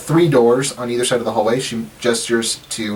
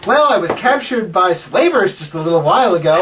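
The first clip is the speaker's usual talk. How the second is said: higher-pitched, animated nephew; Rendil; 7 semitones higher, 3 dB louder than usual. Acted. Nephew telling his aunt of slavers, high animated voice. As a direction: tell it in a lively high voice.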